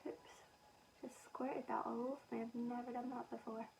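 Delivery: hushed, breathy whisper under her breath; embarrassed whisper. Real, not acted. Vlogger caught out spilling foundation, whispering to herself. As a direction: mutter it to yourself after a spill.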